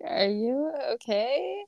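high, slow, tentative check-in; high-pitched, drawn-out mock concern; concerned dog-wash worker. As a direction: imitate a worried stranger asking carefully. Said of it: "I love that the people".

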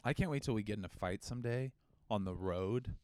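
ordinary talk

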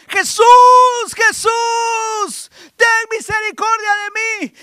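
high, pleading cry of a blind man; blind beggar crying out. Acted. Blind Bartimeo begging Jesus for mercy, high pleading cry. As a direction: call out desperately for mercy. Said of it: "alguien decía".

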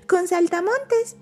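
high, eager exclaim; excited. Acted. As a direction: exclaim with delight. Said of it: "exclamó Pepe".

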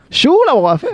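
put-on Chilean southern countryside accent, sung up high. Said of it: "la gente del sur diría así como".

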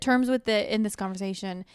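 usual speaking voice

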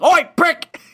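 high, loud imitated yell of Oi, prick. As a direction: bellow it across the room like Ed. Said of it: "I'm like".

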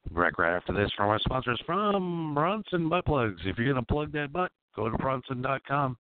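high, loud hyped commercial pitch; ad read. Acted. Host reading a fake sponsor spot, hammed-up announcer. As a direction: oversell it like a cheesy ad.

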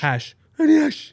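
imitated deaf traveller. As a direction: mimic him asking again, high and loud. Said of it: "this guy would come up to me and go".

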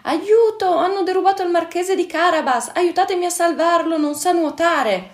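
high, loud, urgent cries for help; shouting for help. A narrator's reading. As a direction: yell it out as if someone is drowning. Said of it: "il gatto cominciò a gridare".

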